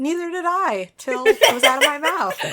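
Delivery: laughing. Real, not acted. Laughing at own surprising score.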